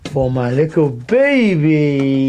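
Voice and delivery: slow, drawn-out doting coo. Real, not acted. Offering cake to the dog.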